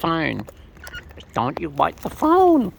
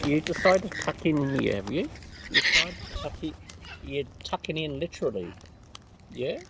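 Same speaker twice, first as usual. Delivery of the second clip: soft, hushed coaxing; softer hushed talk to the birds; 8 dB quieter than usual. Real, not acted. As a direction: murmur so as not to startle the birds.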